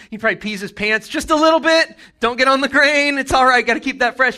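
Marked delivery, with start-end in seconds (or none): 2.2-4.4 higher, louder flustered protest; a startled man shielding his grain, flustered and loud. Retold or imitated. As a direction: fuss over the grain in a panic. Gideon fussing at angel.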